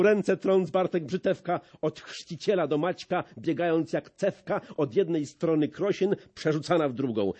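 ordinary delivery

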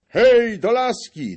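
very high, very loud; rallying yell. A narrator's reading. The Dobrzyńskis rally to the staff, a big yell. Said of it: "dobrzyńscy krzyczą".

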